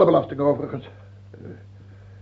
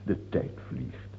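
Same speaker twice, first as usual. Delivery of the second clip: whispered mock musing; 12 semitones lower, 11 dB quieter than usual. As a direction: sigh over the years with fake pity. King whispers mock regret. (zacht, traag gefluister)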